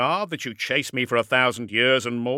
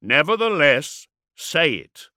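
raised, loud warlord voice; insisting; 5 semitones higher, 7 dB louder, slower than usual. A narrator's reading. Warlord insists the dreadnought swear, loud and insistent. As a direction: press the demand firmly.

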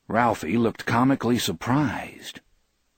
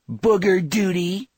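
put-on high-pitched, drawn-out bratty kid voice; little boy's cry; 11 semitones higher, 4 dB louder than usual. A narrator's reading. Little scamp in the wagon taunting a neighbor, childish cry. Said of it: "the little scamp in the wagon cried".

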